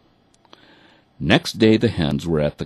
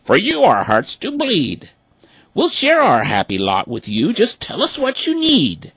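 high, louder duck voice; kindly ducks; 5 dB louder than usual. A narrator's reading. Ducks promising to share with the hens, high. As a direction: offer your home generously as a duck. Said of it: "the ducks all quacked".

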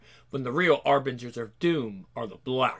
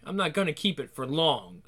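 ordinary talk, nothing marked

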